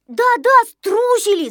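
high, loud voice of a crowd; eager admission. Acted. Everyone owns up in chorus. (высоко и громко, хором соглашаясь)

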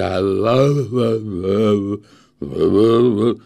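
loud frightened wah wah; trembling stammer. Acted. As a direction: stammer in terror. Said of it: "трясясь всем телом, силится выговорить".

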